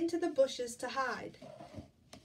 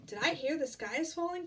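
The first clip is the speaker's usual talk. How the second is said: high, loud question; lightly voiced startled duck; a hint of a quack; Ducky Lucky quacking; 5 semitones higher, 6 dB louder than usual. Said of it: "quacked Ducky Lucky".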